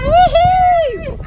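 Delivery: high, joyful woohoo; excited whoop. Real, not acted. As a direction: whoop with delight. Cheering the ready horse.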